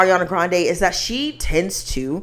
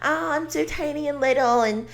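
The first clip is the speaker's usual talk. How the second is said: soft, higher, dainty little voice; high-pitched damsel voice; 4 semitones higher, 8 dB quieter than usual. Retold or imitated. Imitating [name] as Glinda in a soft high damsel voice. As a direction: play the fragile damsel, softly. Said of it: "speaking in this high-pitched voice".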